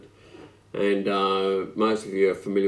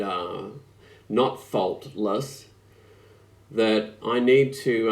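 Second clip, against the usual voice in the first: usual speaking voice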